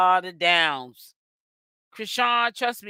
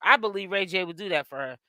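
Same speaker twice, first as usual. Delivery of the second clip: normal talking voice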